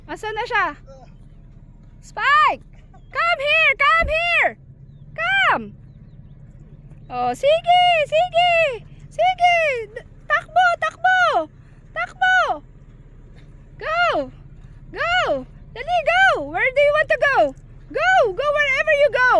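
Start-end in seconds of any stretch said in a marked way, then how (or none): 2.0-12.4 calling the dog. Real, not acted. 13.8-19.3 excited cheering. Real, not acted. Cheering the dog on.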